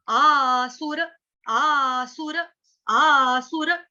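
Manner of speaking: "आसुर" loud and slow, ending on a full "रə". Shown as a demonstration. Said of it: "र पूरा". ("आसुर" पूरे र के साथ, ज़ोर से)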